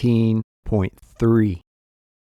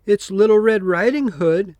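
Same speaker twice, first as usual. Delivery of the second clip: higher, sweet little-girl put-on voice; wolf pretending to be the child, pitched up; wolf faking the girl; 7 semitones higher than usual. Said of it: "said the wolf".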